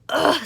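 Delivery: loud grossed-out "Ugh"; disgusted ugh. Acted. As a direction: innkeeper recoiling. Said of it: "said the innkeeper".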